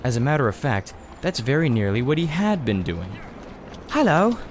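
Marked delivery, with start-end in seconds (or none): 3.9-4.4 put-on high, girlish voice for Polly; Polly, bright girl. A narrator's reading. Narrator voicing Polly greeting the strange boy. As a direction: lift into a young girl's voice. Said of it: "said Polly".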